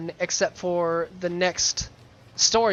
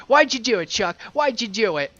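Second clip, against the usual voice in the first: demanding accusation; 5 semitones higher, 5 dB louder than usual. Retold or imitated. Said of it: "And he's like".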